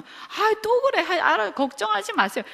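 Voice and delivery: 높고 가볍게 귀찮다는 듯이; 잔소리를 흘려듣는 아이처럼 가볍게; 귀찮은 듯 넘기는 학생. Retold or imitated. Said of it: "이렇게 할 수는 있지만". (부모 잔소리에 건성으로 대답하는 학생, 귀찮은 투)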